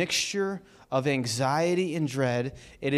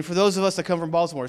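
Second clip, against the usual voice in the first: normal talking voice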